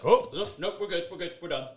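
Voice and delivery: high, quick backing-off quote; defeated villain. Retold or imitated. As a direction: squeak out a hasty surrender. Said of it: "and he's like".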